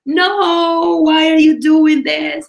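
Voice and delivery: loud, high, drawn-out mock plea; exaggerated teacher's protest, stretched out; acted dismayed protest. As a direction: cry out in playful dismay. Teacher protesting as students skip Shavasana.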